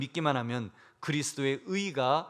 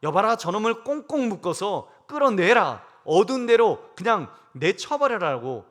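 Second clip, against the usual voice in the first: stern angry king; 4 semitones higher, 6 dB louder than usual. Retold or imitated. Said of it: "하나님이 뭐라고 그러실까요?".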